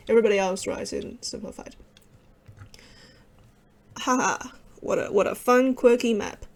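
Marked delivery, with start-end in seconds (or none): none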